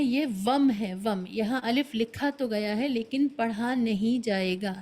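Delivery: normal talking voice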